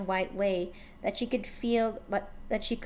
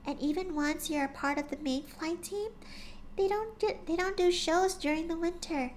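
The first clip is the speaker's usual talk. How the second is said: higher, softer timid pony voice; gentle shy pony; 8 semitones higher, 4 dB quieter than usual. A narrator's reading. Shy pony gently coaxing her friend, soft and high. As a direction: speak like a shy gentle mare.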